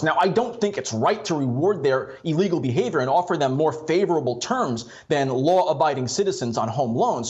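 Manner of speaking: normal talking voice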